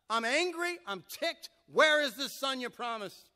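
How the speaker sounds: loud, high, indignant complaint; Abraham telling God he is fed up; frustrated Abraham. Acted. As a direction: vent at God about the missing son.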